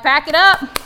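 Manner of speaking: mock alarmed quitting camping; mock exclamation. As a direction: blurt it like bailing on a trip. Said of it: "I'm like".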